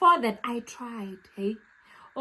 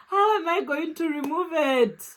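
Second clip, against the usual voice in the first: ordinary talk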